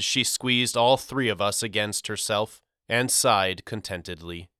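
usual speaking voice

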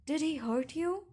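high, loud, angry question; edging toward anger. A narrator's reading. Father through the door about the boyfriend, edging toward anger. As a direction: demand it with rising anger. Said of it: "His tone edged toward anger".

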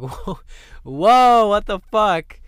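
loud, high startled shout; shocked outburst. Retold or imitated. Kid hearing a classmate in the bathroom, loud shocked shout. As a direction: blurt it out in disbelief, loud and high.

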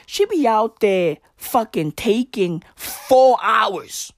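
ordinary talk, nothing marked

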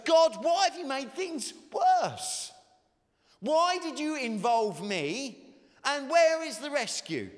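angry, high and loud, pleading; angry questions. Retold or imitated. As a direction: hurl the questions at God. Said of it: "Can you hear Moses' legitimate concerns flavoured with his anger".

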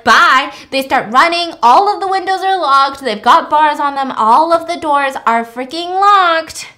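loud, high breathless retelling; excited. Real, not acted. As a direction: rush through the chaos. Chaotic rampage told excitedly.

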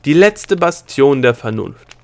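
loud, slow ceremonial call; solemn proclamation. A narrator's reading. Princess before the gate to consciousness, solemn and loud. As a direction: proclaim it grandly, word by word. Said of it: "rief Delia feierlich".

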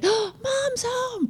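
high, slow gasping delight; excited greeting. Retold or imitated. Mother imitating her teens greeting her at the door. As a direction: gasp and greet like a thrilled kid.

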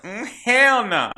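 high, loud, drawn-out mock refusal; sassy refusal. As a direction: stretch it out in indignant defiance. Acting Hela's sassy refusal.

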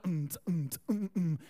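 low hummed thump of party music; imitated music beat. Imitating the party music heard from outside, thumping hum. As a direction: hum a muffled, pulsing bassline. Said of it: "the music is a little bit loud".

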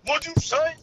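loud high squeaky outrage; high mock outrage. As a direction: yell it in a squeaky voice.

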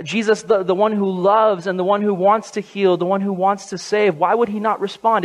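ordinary talk, nothing marked

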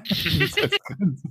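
high, slow, giggly disbelief; incredulous laugh. Real, not acted. Amused disbelief at the limit.

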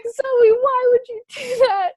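high, loud, drawn-out mock wail; mom freaking out. Mom sees the fake piercing, imitated freaked-out wail. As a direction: imitate a distraught mother wailing in dismay. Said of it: "freaking out".

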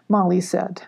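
plain reading voice

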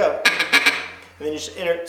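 usual speaking voice

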